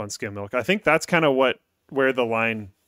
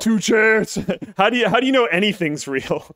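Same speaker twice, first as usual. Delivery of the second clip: high, loud, laughing through it; 9 semitones higher, 6 dB louder than usual. Real, not acted. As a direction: giggle out the words. Laughing, repeats a mind-blowing joke.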